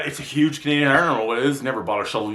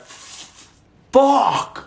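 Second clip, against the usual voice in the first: retold outburst; 12 semitones higher than usual. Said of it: "And I went".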